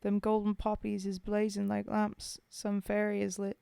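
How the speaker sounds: put-on poem read in a rough British soldier's accent. Streamer reading Bill's Grave to chat, in a British soldier's accent. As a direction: tell it as a homesick Tommy at the grave.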